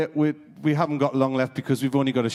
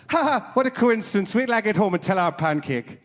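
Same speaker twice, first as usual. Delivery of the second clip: high mock Irish accent; 8 semitones higher, 3 dB louder than usual. Irishman at the bar topping the others, high mock Irish accent. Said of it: "the Irishman went".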